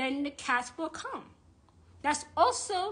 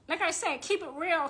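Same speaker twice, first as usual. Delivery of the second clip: usual speaking voice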